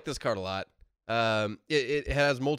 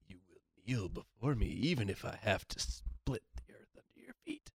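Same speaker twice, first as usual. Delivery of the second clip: hushed, menacing villain whisper; slow theatrical villain menace; 4 semitones lower, 9 dB quieter than usual. Acted. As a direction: whisper it like a threatening overlord. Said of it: "I have to read it".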